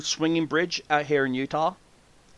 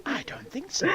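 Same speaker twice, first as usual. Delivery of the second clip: unvoiced higher whisper; 9 semitones higher, 11 dB quieter than usual. Real, not acted. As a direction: breathe it as a sly aside. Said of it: "whispered aside".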